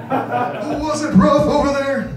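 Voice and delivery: high, concerned lady voice; mock worried lady. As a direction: ask with wide-eyed sympathy. Lady asking.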